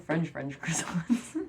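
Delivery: slow, breathy whisper with a laugh; whispered aside. Retold or imitated. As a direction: let it slip out as a secret, slowly. Confiding the real truth, laughing.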